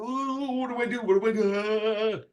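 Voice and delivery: high, panicky mock wail; flustered panic. Writer whose pitch sheet was taken away, mock panic. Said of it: "they bumble and go".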